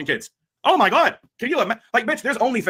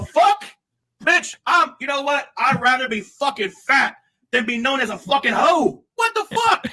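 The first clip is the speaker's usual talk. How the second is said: shouting it out, pitch climbing high; yelling in disbelief at her; furious shout; 5 semitones higher than usual. Real, not acted. Angry rant, shouting.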